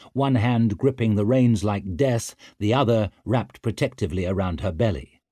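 plain reading voice

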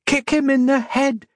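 very high, urgent call; high-pitched yelled order; yelled from above. A narrator's reading. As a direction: call it down high, held in volume. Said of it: "Octavia yelled from above".

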